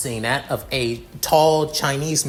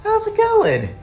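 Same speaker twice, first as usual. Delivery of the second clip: a sheepish hello climbing much higher; nervous greeting; 14 semitones higher, 3 dB louder, slower than usual. Acted. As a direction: play it innocent, voice rising to a squeak. Caught, nervously greeting angry friend.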